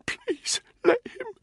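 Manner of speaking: breathless, broken whimper; failing plea. A narrator's reading. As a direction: beg weakly, unable to finish. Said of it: "He couldn't finish his sentence".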